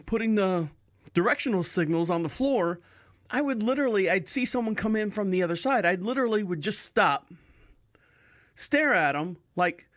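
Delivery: ordinary talk, nothing marked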